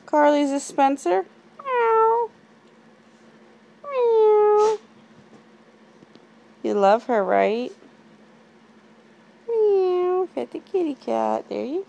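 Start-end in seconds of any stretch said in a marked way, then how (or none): 1.6-4.8 loud high imitated meow; high cat meow. Parent meowing for kids, high loud cat. 9.5-10.3 higher imitated meow; high cat meow. Parent meowing for kids, high cat.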